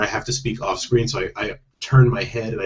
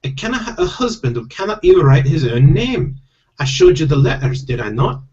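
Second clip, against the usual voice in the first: high, insistent Scottish wife; higher, louder stage-Scottish voice; Scottish accent; 6 semitones higher, 4 dB louder than usual. Acted. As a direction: press him in a put-on Scottish accent. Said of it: "I do have to do Scottish accents".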